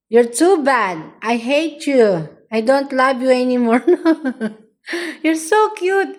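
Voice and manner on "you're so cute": cooing. Real, not acted. Owner cooing fondly at her pet, high.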